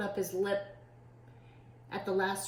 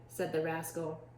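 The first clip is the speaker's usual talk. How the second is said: plain reading voice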